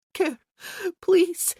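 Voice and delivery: high, loud desperate plea; pleading cry. Acted. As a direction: beg out loud. Female character in ecstasy.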